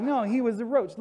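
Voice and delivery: high, emphatic insistence; insistent. Retold or imitated. Telling a friend, insisting the roach was his pet. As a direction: press the point that it was a roach.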